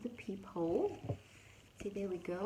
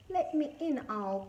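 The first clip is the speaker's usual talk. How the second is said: high-pitched call to be let in; 7 semitones higher than usual. A narrator's reading. Rabbit calls to Owl from outside in the storm, calling out. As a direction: call through the door, pitched well up. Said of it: "cra-, called Rabbit".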